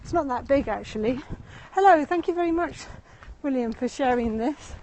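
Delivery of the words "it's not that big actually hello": usual speaking voice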